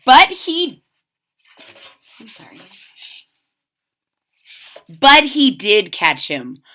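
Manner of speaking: plain reading voice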